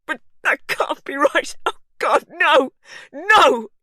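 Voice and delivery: high, loud wailing; husband wailing over dead wife; anguished grief. A narrator's reading. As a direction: wail in disbelief. Hugh grieving.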